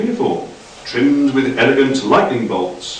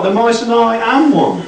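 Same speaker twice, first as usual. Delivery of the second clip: much higher, fussy correction; squeaky Doc; 11 semitones higher than usual. A narrator's reading. Doc correcting Frank's grammar, high fussy voice. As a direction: pipe up high and prissy. Doc's pedantic line.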